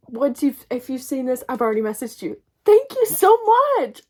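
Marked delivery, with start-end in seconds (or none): 2.6-4.0 high, loud burst of thanks; excited thanks. Real, not acted. Vlogger calling out thanks to Tori, excited. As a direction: shout your thanks happily.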